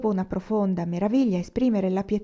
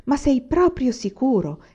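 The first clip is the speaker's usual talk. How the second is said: higher, disbelieving question, stretched on the repeat; astounded; 5 semitones higher, 4 dB louder than usual. A narrator's reading. Old woman doubts what Anania tells her, astounded. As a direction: ask it stunned, pressing for certainty. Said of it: "chiese la vecchia sbalordita".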